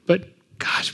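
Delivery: 哽咽气声; breathless, near tears; choked up. Real, not acted. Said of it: "I can get a little bit weepy".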